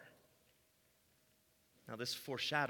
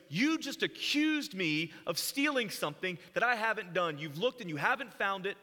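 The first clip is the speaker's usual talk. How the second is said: raised, heated voice, pitch up high; intense angry protest; 5 semitones higher, 5 dB louder than usual. Acted. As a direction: confront him hotly, voice climbing. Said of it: "You can hear the, the stress in his voice".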